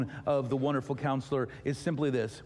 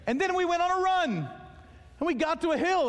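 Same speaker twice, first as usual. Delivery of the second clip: excited, laughing. Real, not acted. Amused rant after caw bit.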